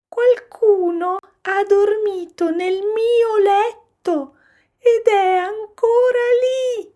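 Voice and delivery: put-on very high, loud baby-bear squeal; little bear crying out. A narrator's reading. Little bear spotting someone in his bed, shrieking. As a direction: shriek it like an alarmed cub. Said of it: "gridò piccolo orso".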